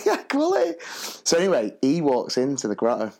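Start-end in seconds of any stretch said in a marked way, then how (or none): 0.0-0.8 high, laughing retort; amused, pitched-up acknowledgement; mock reply. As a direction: toss it off in a put-on high voice. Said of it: "I was like".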